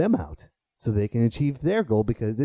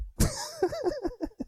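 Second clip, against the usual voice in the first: high laugh; 3 dB quieter than usual. Real, not acted. Laughs at own joke.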